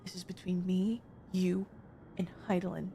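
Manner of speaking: hushed conspiratorial whisper. Real, not acted. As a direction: whisper it like a secret. Secret aside to chat.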